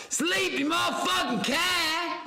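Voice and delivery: high, slow yell. Real, not acted. Streamer yells high and slow at sleepy cat. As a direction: bellow it across the room.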